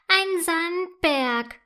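slow, high, drawn-out scoff; mocking. A narrator's reading. Girl mocks the children's sand castle, slow and scornful. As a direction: sneer at the little sand castle. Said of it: "sagt Yvonne spöttisch".

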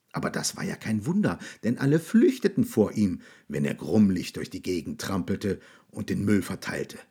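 usual speaking voice

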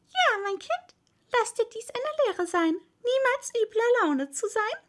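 put-on high, lecturing old toad; high-pitched motherly admonishment. A narrator's reading. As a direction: lecture a child in a high creature voice. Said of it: "eine alte Unke".

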